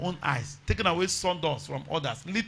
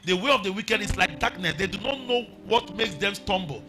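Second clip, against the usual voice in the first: raised, ringing preacher voice over music; hollering revival preacher; 4 semitones higher than usual. Acted. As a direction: preach it out, climbing higher. Preaching at full force with music.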